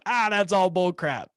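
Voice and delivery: a dismissive skeptic brushing off the idea; mock skeptic. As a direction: scoff like a doubting listener. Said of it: "the guy listening to this going".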